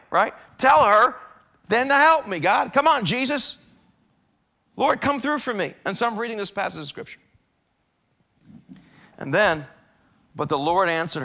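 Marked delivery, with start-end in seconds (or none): 0.6-5.7 high, loud pleading demand; insist, almost shouting; exasperated demand. Acted. Martha begs Jesus to make Mary help, high loud exasperated demand.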